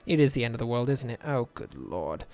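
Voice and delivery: quiet, sinking mock despair. Builder despairing over his broken road, mock despair. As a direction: sigh it out as if all is lost.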